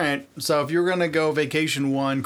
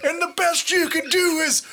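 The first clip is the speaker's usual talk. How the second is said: high, strained wrestler rasp; Terry Funk voice; 13 semitones higher than usual. Retold or imitated. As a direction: push the voice up like a cartoon prospector. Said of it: "talking like this".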